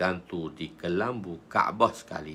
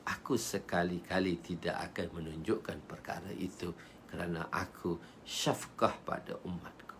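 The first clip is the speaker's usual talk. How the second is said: low, soft, compassionate answer; gentle refusal; 3 semitones lower, 10 dB quieter than usual. Acted. Prophet explains compassion for his people, soft and low.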